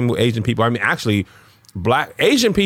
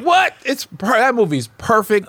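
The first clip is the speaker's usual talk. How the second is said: much higher, playful disbelief; mock outrage; 10 semitones higher, slower than usual. Friend playing outraged that the movie gets criticized, high mock disbelief. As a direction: act shocked anyone would criticize it.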